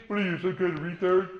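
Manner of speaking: a hint of a mocking bully, voice put on; lightly voiced taunting bully. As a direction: sneer it like a schoolyard bully. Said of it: "bullies, like, change their voice".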